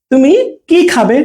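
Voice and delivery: gap after "তুমি", then drawn "কি"; space before long কি. Shown as a demonstration. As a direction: ask what, leaning on "কি". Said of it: "একটু space দিয়ে কিটা". ("তুমি", বিরতি, "কি" টেনে)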